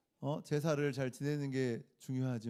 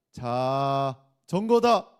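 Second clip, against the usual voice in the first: "자전거다" with a long drawn-out first syllable, then loud; suspenseful stretch; 8 dB louder, much slower than usual. Pretend. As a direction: hold "자" for suspense, then punch "전거다". Teasing wrong word in quiz.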